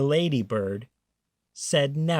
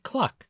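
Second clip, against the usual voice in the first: high clucking hen sound; 10 semitones higher than usual. A narrator's reading. Reading to children, a high hen's cluck. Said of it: "and the hen said".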